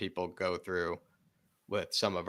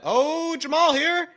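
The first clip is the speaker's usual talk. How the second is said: excited shout; 12 dB louder, slower than usual. Real, not acted. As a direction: voice jumps high, loud and sudden. Sudden chance in the match.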